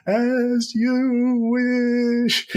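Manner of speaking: very high, drawn-out wail of the words; rolling down a hill. Retold or imitated. Mimicking the hero rolling down the hill, high drawn-out wail. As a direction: stretch every word high and slow as if tumbling downhill. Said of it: "he's rolling down it going".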